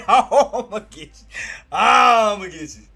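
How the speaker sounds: high, loud, drawn-out teasing menace; laughing mock threat stretched slow; hammed-up vow of revenge; playful threat.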